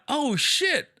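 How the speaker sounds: sudden realization. Retold or imitated. Comic realizing the manager's favor, startled and high. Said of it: "I went".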